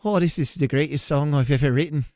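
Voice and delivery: put-on wobbly Irish accent sliding toward Scottish; attempted Irish accent. Streamer playing U2's [name] on his song, shaky Irish accent. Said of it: "I'm trying to do Irish".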